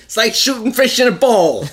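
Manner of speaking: high, hammed-up old-timey exclamation. Cohost joins the duck soup bit, old-timey voice. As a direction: pitch it up like an old-picture character. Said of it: "In an old-timey voice".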